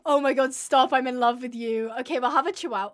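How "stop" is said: ordinary talk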